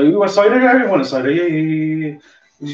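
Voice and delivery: put-on loud, high broad Somerset accent; proper Somerset. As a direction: ham up a Somerset farmer's twang. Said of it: "I go proper Somerset".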